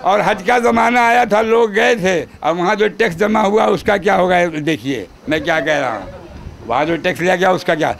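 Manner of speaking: ordinary delivery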